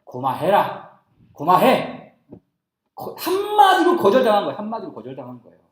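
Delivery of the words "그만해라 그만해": loud, blunt Gyeongsang dialect. Shown as a demonstration. God's refusal put bluntly in Gyeongsang dialect. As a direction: cut them off in Gyeongsang dialect. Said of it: "경상도 사투리로 말하면".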